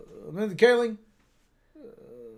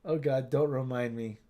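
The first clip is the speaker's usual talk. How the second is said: usual speaking voice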